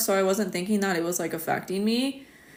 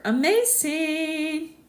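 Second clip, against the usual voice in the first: drawn-out amazing; 9 semitones higher, much slower than usual. Real, not acted. Reacting to a viewer's news.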